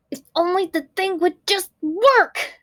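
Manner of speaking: high, strained cry of frustration; frustrated girl. A narrator's reading. Girl fed up with her broken invention, high and exasperated. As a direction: push it out like she's at the end of her rope. Girl's frustrated outburst voiced.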